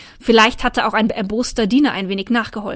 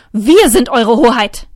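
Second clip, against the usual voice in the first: slow, loud furious royal shout; furious king; 8 dB louder, slower than usual. A narrator's reading. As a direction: bellow in outrage. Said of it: "violett vor Zorn". (wütender König, laut und langsam)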